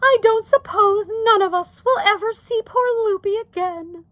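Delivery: high, plaintive Homley, understated tears; tearful. A narrator's reading. Wife mourns the missing aunt, tearful. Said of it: "her eyes full of tears".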